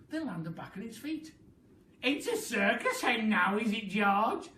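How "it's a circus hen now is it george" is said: laughing. Acted. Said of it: "laughed Grandma".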